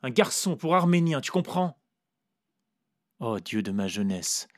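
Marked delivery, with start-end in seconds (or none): 0.0-1.8 higher, louder insistence; pressing. A narrator's reading. The narrator insists to Vera, higher and louder. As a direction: force her to understand.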